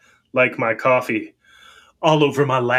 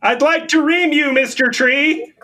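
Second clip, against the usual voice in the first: cheesy pickup line; 13 semitones higher, 3 dB louder than usual. Pretend. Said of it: "catcall some trees".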